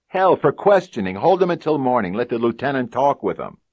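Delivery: high, loud, heated insistence; exasperated partner. Acted. Fat partner pressing the sergeant, high heated argument. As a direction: argue it out, worked up.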